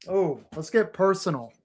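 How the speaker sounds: ordinary delivery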